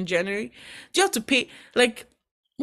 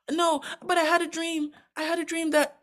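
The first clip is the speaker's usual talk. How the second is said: put-on higher, fast, bragging kid brother; boastful young Joseph; 9 semitones higher than usual. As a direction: crow it like a cocky favourite son. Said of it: "I imagine that's how Joseph was talking".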